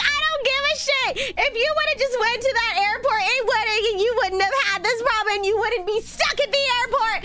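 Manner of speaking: high, ranting imitation of mom; pissed-off mom. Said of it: "my mom was actually pissed at me".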